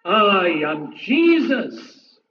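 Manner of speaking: slow, weighty divine declaration; slow solemn proclamation; voice of Jesus. Acted. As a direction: announce it slowly with solemn majesty. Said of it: "he will say".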